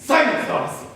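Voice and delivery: loud, high outburst; angry command. Acted. Pierre cutting Dorothy off mid-excuse, angry command. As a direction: shut her up with a sudden shout. Blackmailer silences his victim.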